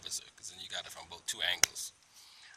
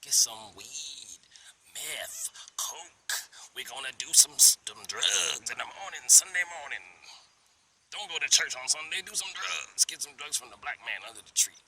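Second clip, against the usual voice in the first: sarcastic mock drug deal; 4 semitones higher, 3 dB louder than usual. Man mocking officer's suspicion of drugs, sarcastic. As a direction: ham up the absurd Sunday drug pitch.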